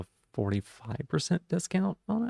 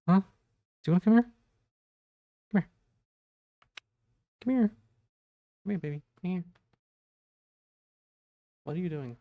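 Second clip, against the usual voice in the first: much higher, sing-song pet talk; calling the cat; 7 semitones higher, much faster than usual. Real, not acted. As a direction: coax the kitty to come over.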